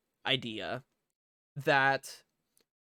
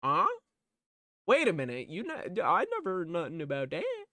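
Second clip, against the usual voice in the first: hammed-up confused reaction, pitch swooping up; playfully exaggerated disbelief; mock bafflement; 4 semitones higher, faster than usual. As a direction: act out a clueless guy caught off guard. Acting out his baffled reaction.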